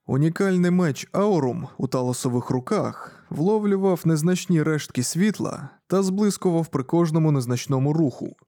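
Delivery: ordinary delivery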